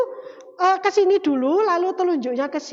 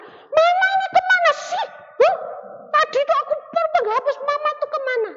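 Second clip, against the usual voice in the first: put-on much higher, louder cross child's voice; angry child; 12 semitones higher, 4 dB louder than usual. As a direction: scold mum like an upset kid. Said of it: "langsung marah". (anak marah dengan suara tinggi)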